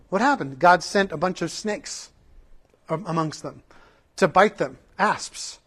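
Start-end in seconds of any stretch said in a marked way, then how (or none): none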